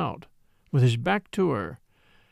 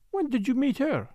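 higher-pitched, sharp French dancer; sharp question; 9 semitones higher than usual. A narrator's reading. Jealous dancer questioning Derek about another woman, sharply. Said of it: "Morel said sharply".

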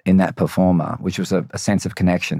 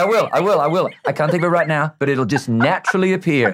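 panicked self-reassurance; 6 semitones higher than usual. Retold or imitated. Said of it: "And I'm like".

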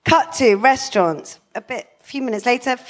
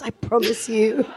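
normal talking voice

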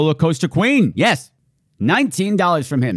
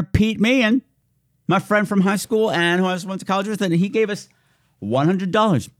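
ordinary delivery

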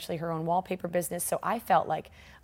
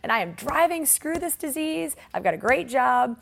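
giddy triumph; 6 semitones higher, 4 dB louder than usual. Retold or imitated. To herself after buying the convertible, giddy triumph. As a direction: crow about the new car like you've won.

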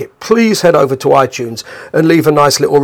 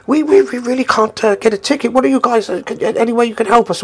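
higher-pitched, hesitant request; pleading stranger; 9 semitones higher than usual. Retold or imitated. Man outside asking the band to get him in, pleading. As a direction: imitate someone begging a favour, stumbling at the start.

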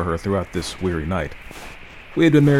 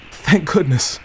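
higher, louder burst of relief; relieved exclamation; 7 semitones higher, 4 dB louder, slower than usual. A narrator's reading. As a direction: blurt it out in relief. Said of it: "was the exclamation that burst involuntarily".

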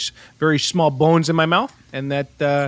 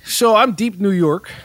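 put-on heavy Brooklyn accent; 5 semitones higher, slower than usual. Taxi instructor showing trainees his accent, heavy Brooklyn. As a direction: lay on a thick Brooklyn accent. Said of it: "this is a heavy, heavy Brooklyn accent".

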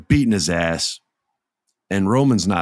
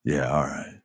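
grudging assent; 8 semitones lower, 7 dB quieter than usual. Retold or imitated. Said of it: "Roman finally nods".

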